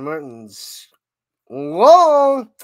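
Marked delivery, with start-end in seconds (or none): 1.5-2.5 high, loud drawn-out holler; excited out call. Real, not acted. Commentator calling the ball out, excited holler.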